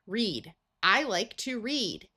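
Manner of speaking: ordinary talk, nothing marked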